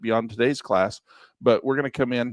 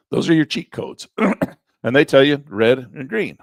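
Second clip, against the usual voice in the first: strained, losing his voice; 3 semitones higher, 6 dB louder than usual. Real, not acted. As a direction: push through a failing voice. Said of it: "I'm losing my voice".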